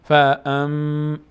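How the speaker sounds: slow chanted فام ending in a long hum; nasal hold on فام. Shown as a demonstration. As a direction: draw out the م of فام in the nose.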